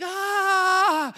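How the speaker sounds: high, loud comic "aah" of panic; terrified scream. Acted. Shepherds seeing a huge angel appear, panicked scream.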